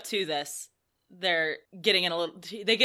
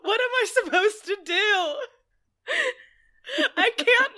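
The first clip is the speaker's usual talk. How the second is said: high, slow, giggling quote; laughing disbelief; 12 semitones higher, 3 dB louder than usual. Real, not acted. Host quotes the absurd phrase, laughing. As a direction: say it as if you can't believe it. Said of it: "he's using the phrase".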